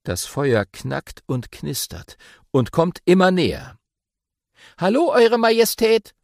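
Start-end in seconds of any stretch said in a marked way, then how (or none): 4.8-6.2 high, loud call across the fire. A narrator's reading. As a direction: shout to get the king's attention. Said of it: "ruft Tafiti laut".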